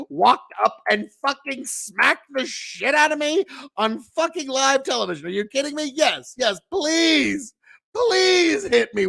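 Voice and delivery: high, eager mock plea rising to a squeal; imagined Chris Rock, thrilled, begging for the slap; gleeful begging. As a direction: ham up the thrilled comedian begging to be hit. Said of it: "there's probably a part of him that's thinking".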